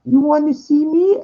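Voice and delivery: high falsetto imitation of a woman's voice; woman on the phone. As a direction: squeak it up high like the caller. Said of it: "And she goes".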